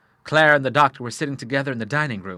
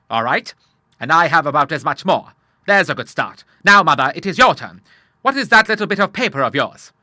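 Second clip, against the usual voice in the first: loud, higher, buoyant father; rally the family eagerly; hearty Admiral; 5 semitones higher, 7 dB louder than usual. Acted.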